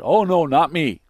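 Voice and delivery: mock-boastful loud protest; a loud, cocky denial in Peter's voice. Preacher mocks boastful Peter.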